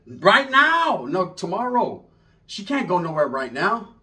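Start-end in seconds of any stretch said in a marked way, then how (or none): 0.1-1.1 surprised question. Retold or imitated. Tia surprised at nephew's answer.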